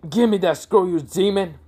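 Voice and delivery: high-pitched, mock-angry yell; angry demand. Mizuki orders the demon to hand over the scroll, angry demand.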